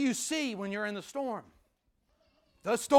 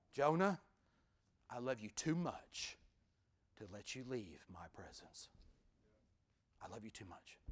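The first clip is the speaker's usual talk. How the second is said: God speaking tenderly to Jonah; 7 semitones lower, 6 dB quieter than usual. Acted. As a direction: speak gently to a runaway prophet. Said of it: "what the storm spoke loudly to Jonah from God".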